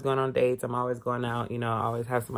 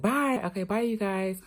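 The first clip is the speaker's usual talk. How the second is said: breezy fake goodbye; 7 semitones higher than usual. Sneaking out hiding the bag.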